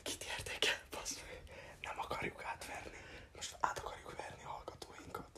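hushed unvoiced whisper; conspiratorial whisper to co-host. Real, not acted. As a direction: whisper it so listeners won't hear. Whispered aside to partner.

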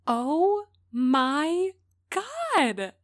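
high excited squeal; staccato. Acted. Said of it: "Caroline's staccato tone got higher with every word".

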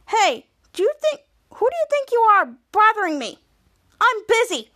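annoyed scolding. Retold or imitated. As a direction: scold an unwanted caller, play-acted. Club leader scolds the caller.

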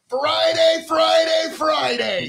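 loud, drawn-out hype announcer shouts. Acted. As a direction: sell the event like a wrestling promo. Hyping event, repeated shouts.

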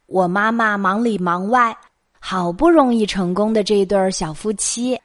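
ordinary delivery, nothing marked

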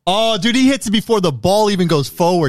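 high-pitched, worked-up objection; indignant sports-fan outburst; real protest. Real, not acted. Disputing a referee's call on replay.

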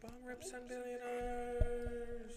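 slow, drawn-out show title with echo; echo effect. Shown as a demonstration. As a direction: stretch the title out over the effect. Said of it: "Give me some effect".

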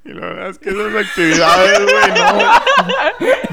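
laughing high through the words; giggling out of nerves; nervous laugh. Real, not acted. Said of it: "me río de nervios".

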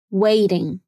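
careful American "waiting", t lightly tapped; flap T. Shown as a demonstration. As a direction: say "waiting" like "wading". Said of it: "Vamos treinar mais algumas palavras".

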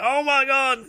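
high, drawn-out wail; hammed-up high-pitched alarm; mock panicked cry. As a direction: cry out as if blasted backwards. Blasted character flies backwards.

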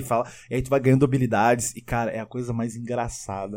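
ordinary delivery

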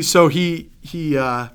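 ordinary talk

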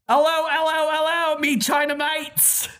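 high, loud hammed-up Cockney greeting. Reading a listener email aloud in hammed-up Cockney. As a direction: belt it out like a stage Cockney. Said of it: "I'm just trying to really dig into".

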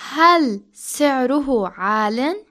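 slow, careful Arabic question. Shown as a demonstration. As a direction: spell it out slowly for learners. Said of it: "One more time, slowly".

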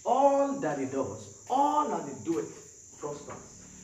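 slow, drawn-out emphasis on ALL; emphatic verse. Real, not acted. Preacher repeats the read verse to congregation, slow and emphatic. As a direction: stretch each phrase, weighing the words.

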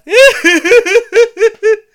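big, dumb, high, loud guffaw; Bill Hader laugh. Retold or imitated. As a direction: let out a goofy belly laugh. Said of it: "that big dumb Bill Hader laugh".

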